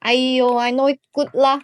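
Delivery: put-on loud Malaysian-English accent, lah at the end. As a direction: Malaysian accent, playful and quick. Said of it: "why am I speaking in Malaysian accent".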